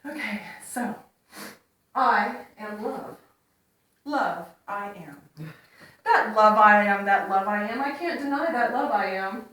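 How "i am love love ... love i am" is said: poem recitation. Acted.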